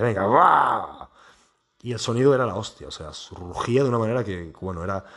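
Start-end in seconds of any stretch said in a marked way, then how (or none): 0.2-1.1 long drawn-out "guaaa" roar; loud, stretched engine roar; imitated roaring launch. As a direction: mimic a powerful car taking off. Mimics flooring the powerful car.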